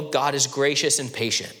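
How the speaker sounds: usual speaking voice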